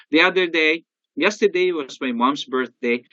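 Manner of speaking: normal talking voice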